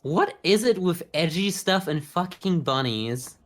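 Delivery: ordinary talk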